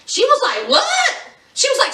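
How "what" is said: very high-pitched incredulous yelp; mock disbelief. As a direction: squeal it, imitating her astonishment. She reacts to his odd remark.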